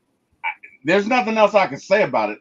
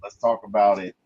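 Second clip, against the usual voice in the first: soothing friend; 7 semitones lower than usual. Retold or imitated. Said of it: "And she's like".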